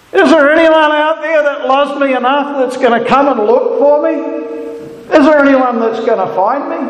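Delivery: put-on high, lonely pleading voice of a lost teen; lost teenager's cry. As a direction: call out into the dark like someone lost. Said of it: "the cry of a lost person or teenager".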